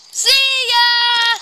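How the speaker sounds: shouted cheerful goodbye; loud goodbye. Real, not acted. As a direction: call it out to the viewers. Loud sign-off.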